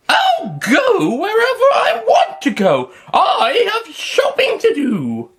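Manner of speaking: put-on high, slow, haughty old woman; a proud old lady, drawn out and indignant. Stubborn old woman voiced.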